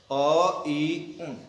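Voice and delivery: drawn-out अ and ई, then उन closed without a final vowel; half-length final ण. Shown as a demonstration. Teacher repeats the correct recitation for students, half-length final ण. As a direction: stop उन on the nasal, adding no "a". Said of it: "तो इस तरीके से करना है".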